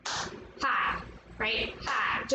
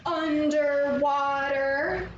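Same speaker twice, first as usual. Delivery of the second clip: "underwater" slowly stretched over four beats; much slower than usual. Shown as a demonstration. Teacher shows four underwater waves, syllable by syllable. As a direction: draw out "underwater", a beat per syllable. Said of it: "four beat underwater movement".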